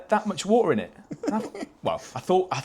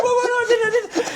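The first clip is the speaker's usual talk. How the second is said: very high, loud panicked stammer; imitated freaking out; 7 dB louder than usual. Roommate woken and accused of pissing, imitated freaking out. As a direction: mimic a panicked denial at the top of the voice. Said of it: "He's, like, freaking out".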